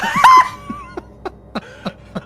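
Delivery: a sudden high, loud burst of laughter. Real, not acted. Laughing at running movie joke.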